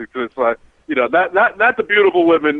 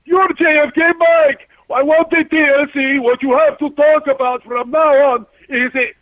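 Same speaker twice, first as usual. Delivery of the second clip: excited cab driver; 11 semitones higher, slower than usual. Retold or imitated. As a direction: imitate a thrilled cab driver spotting a celebrity. Cab driver retold excitedly recognizing him.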